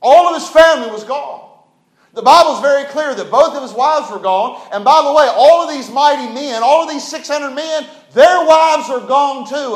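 loud, high, fervent preaching; preacher raising his voice over the congregation; preaching at full force. Acted. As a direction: drive it home from the pulpit.

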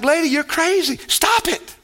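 exasperated outburst jumping high on the order; Tim Conway's psychologist. Acted. Psychologist telling a lady she's crazy, exasperated high command. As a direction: cut the patient off with a high-pitched command. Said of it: "Tim Conway played a psychologist".

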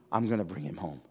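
ordinary talk

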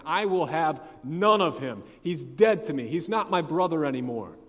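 loud, acted fury at a brother; hammed-up furious refusal; angry disowning. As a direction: renounce him with hammered force. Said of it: "The text says he was angry".

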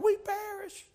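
high-pitched imitated cry of alarm; disciples crying out. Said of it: "The disciples cry out".

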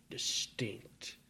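a whispered single word. Acted. Preacher describes Jesus's voice to the church, in a whisper. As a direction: hush it right down.

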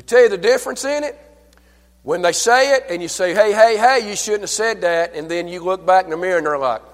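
high, loud pulpit delivery; excited preacher's shout; loud animated preaching. Real, not acted. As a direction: raise the voice and drive the contrast home. Preaches loudly, mimicking a scolding.

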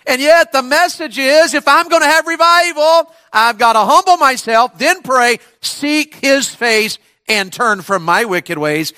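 high, urgent preacher's cry; full-force preaching. Real, not acted. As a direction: drive each step home, slowing towards the end.

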